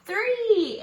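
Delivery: high, loud announced answer; loud cheerful count; excited answer. Acted. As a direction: call out the answer like a reveal.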